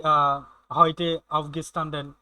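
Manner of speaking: spoken very slowly, word by word; slow German example. Shown as a demonstration. Teacher reading a German phrase slowly to students. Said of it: "teaching German phrase".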